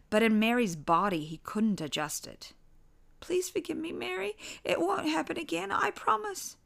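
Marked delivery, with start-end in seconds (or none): 3.2-6.6 put-on pleading child voice. A narrator's reading. Father pleading in child body.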